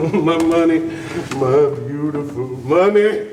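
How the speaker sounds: gloating. Acted. Robber gloating over returned money.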